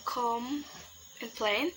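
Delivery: "complain" split by a clear pause after com; broken into syllables. Shown as a demonstration. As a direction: say "complain" as two separate syllables. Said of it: "the syllable is".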